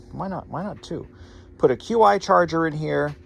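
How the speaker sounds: ordinary talk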